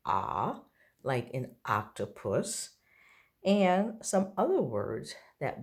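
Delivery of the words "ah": a low, open "ah"; short o sound. Shown as a demonstration. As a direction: drop the voice on a short "ah". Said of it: "short sound".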